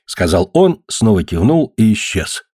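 usual speaking voice

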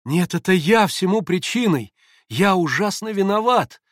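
high, slow cry of self-blame; drawn-out, distressed confession; inconsolable shame. A narrator's reading. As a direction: blame yourself in an anguished outburst. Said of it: "повторял неутешный Алёша в порыве мучительного стыда".

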